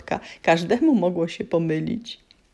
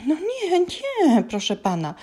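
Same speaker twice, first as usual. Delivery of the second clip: high, meek, polite elf; frightened elf answers the giant politely; polite squeak; 11 semitones higher than usual. A narrator's reading. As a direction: plead politely in a small voice. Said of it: "powiedział grzecznie elf".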